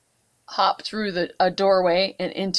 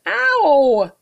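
pained yelp; 8 semitones higher, 7 dB louder than usual. Acted. Frog lands in empty barrel.

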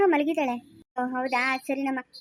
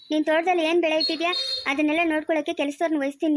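ordinary talk, nothing marked

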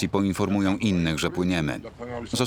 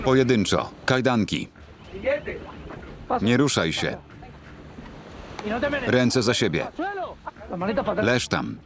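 shouted commands; 6 semitones higher than usual. Real, not acted. Officers arresting smugglers.

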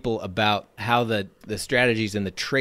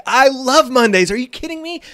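much higher and louder, bursting with enthusiasm; excited; 12 semitones higher, 6 dB louder than usual. Retold or imitated. Boss telling the receptionist he loves Mondays, excited. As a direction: gush about loving Mondays. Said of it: "I started saying all this stuff about how much I love Mondays".

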